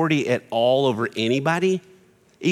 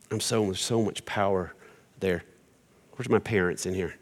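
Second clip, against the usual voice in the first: ordinary delivery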